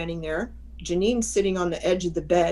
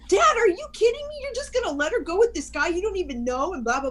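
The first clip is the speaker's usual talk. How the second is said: put-on high, indignant kid-sister protest, fast; outraged little sister; 12 semitones higher, faster than usual. Little sister protests to dad about letting her go. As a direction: whine it at dad like a scandalised teen. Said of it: "she goes".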